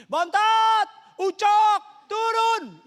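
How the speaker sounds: very high, very loud calling out; mother yelling. Retold or imitated. Mother calling her children down to eat, piercing yell. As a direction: holler like a mother calling upstairs. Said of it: "Dia teriak lagi".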